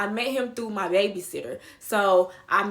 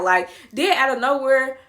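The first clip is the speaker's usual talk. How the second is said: usual speaking voice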